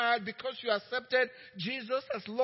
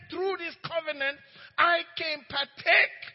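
higher, loud fervent proclamation; preaching shout; 5 semitones higher, 6 dB louder than usual. Acted. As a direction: rouse the congregation with it. Preacher proclaims God's words.

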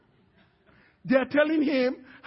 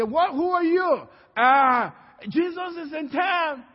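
high, raised-voice doorstep exchange; urgent calling out; 4 semitones higher, 4 dB louder than usual. Acted. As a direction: announce urgent news to a household. Acted exchange at the door.

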